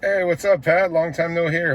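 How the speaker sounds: ordinary talk, nothing marked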